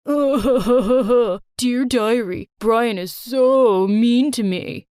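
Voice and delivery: hammed-up crybaby parody of her diary; mocking diary voice. Bully mocks her diary in a slow whiny voice.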